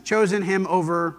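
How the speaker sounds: usual speaking voice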